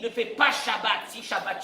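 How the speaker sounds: usual speaking voice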